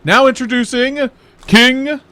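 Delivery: loud, slow grand herald proclamation; royal announcement. Pretend. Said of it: "announce it in your most royal voice".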